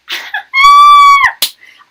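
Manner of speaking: high, loud burst of delighted laughter; shrieking laugh. Real, not acted. Hearing the harassing instructor got fired, a shrieking laugh. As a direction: let a shrill cackle of glee explode. Laughing about his firing.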